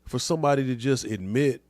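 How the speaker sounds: ordinary talk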